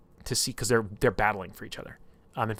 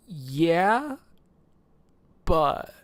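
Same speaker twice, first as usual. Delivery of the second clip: high, louder hesitant yeah, long pause; acted sheepish confession, haltingly; reluctant admission; 9 semitones higher, 7 dB louder than usual.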